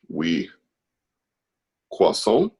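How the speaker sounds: token French accent. Shown as a demonstration. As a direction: put on a minimal mock French accent. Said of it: "kind of the limit of my French".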